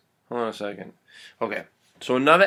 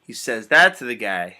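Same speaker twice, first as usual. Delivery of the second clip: ordinary delivery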